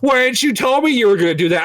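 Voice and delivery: indignant friend. Retold or imitated. Friends complaining about missed proposal.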